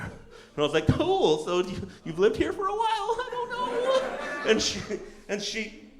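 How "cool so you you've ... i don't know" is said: high, uneasy chatter; mock nervous small talk. Uneasy passenger changing the subject, mock nervous small talk. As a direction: pitch it up into forced cheer.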